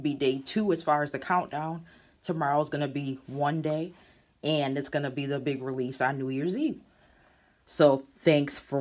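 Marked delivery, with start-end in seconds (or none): none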